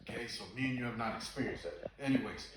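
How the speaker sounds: usual speaking voice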